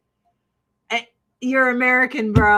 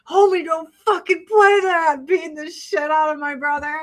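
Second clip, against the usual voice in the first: high, loud mock-crying yell; crying and screaming; 6 semitones higher, 3 dB louder than usual. Child fighting brother in the yard, tearful screaming. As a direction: imitate a kid wailing mid-fight. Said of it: "crying and screaming in the yard".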